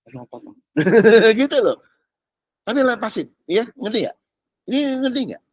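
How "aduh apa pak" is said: very soft, low murmured answer; quiet mock reply. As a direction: answer the noisy petitioner in a hush. Voicing God's reply to shouting.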